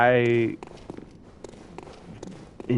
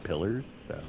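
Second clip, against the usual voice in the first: usual speaking voice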